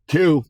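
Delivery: Two, stressed high and loud; emphatic count. Retold or imitated. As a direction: punch the word Two like a judge. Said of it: "judge looked and goes".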